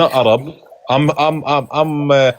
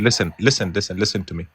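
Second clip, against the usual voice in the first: quiet; 6 semitones lower, 7 dB quieter than usual. Real, not acted. Calming a heated argument.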